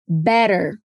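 careful "better" with a tapped t; flap T. Shown as a demonstration. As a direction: say "better" American style, like "bedder". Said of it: "Vamos treinar mais algumas palavras".

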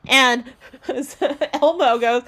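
usual speaking voice

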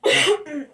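short dry cough from laughing. Real, not acted. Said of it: "la tos por la risa".

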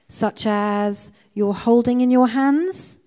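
loud, slow prompt rising high on "hands"; leading question. A narrator's reading. Betsy prompts Corrie about the Bible, leading question. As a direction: draw out "Such as you're holding", lifting to "hands".

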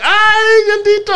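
high, loud emotional Spanish cry; emotional Spanish commentator. Retold or imitated. Host imitates Spanish commentary of the hug, high and emotional. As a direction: imitate a passionate Spanish announcer. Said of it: "so much more emotional in the Spanish voice".

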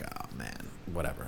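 low, soft, deflated "oh man, whatever"; quiet disappointed dismissal; mumbled shrug. Retold or imitated. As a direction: brush it off under your breath. Disappointed shrug, quoted self.

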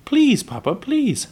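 put-on high, loud childlike pleading; begging. A narrator's reading. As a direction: beg Dad urgently, pitch leaping up. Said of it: "Laura begged".

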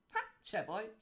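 a loud, bright yelp of glee; cheery shout. Acted. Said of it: "rikkantott a róka".

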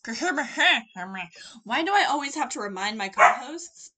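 loud, stammering mock outrage; loud exasperated rant. Real, not acted. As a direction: sputter at the co-hosts.

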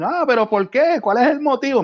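high, whining why-me voice; whiny protest. Retold or imitated. Offender whines to the policeman, high and indignant.

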